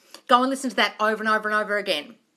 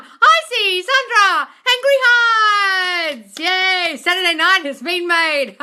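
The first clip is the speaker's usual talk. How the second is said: excited drawn-out shout; 7 semitones higher, 7 dB louder, much slower than usual. Real, not acted. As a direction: stretch the name out loud. Excited name roll call.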